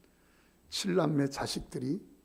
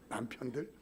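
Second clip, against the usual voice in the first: usual speaking voice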